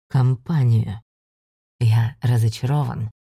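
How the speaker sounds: low, cold and disappointed; disdainful low voice. Acted. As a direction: sneer it down at him, slowly.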